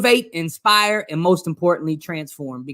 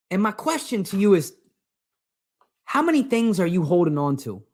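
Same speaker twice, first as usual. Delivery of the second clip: usual speaking voice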